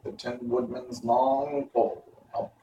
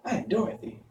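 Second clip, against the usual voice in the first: put-on girl's voice, pitched much higher; a young girl, higher and earnest; Dorothy voice; 10 semitones higher, 5 dB quieter, faster than usual. A narrator's reading. Dorothy to friends on the riverbank, higher and earnest. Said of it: "answered the girl".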